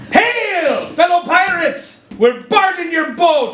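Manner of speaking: high, slow hearty pirate hail. Pretend. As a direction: hail them like a swaggering pirate. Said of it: "put on your best pirate voice".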